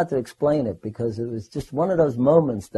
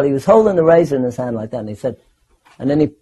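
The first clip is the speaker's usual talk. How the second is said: plain reading voice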